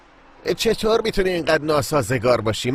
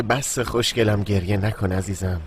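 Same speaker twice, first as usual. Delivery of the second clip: tender comforting; 9 semitones lower, slower than usual. Acted. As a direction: hush her gently like you're wiping her tears. Groom comforts crying bride softly.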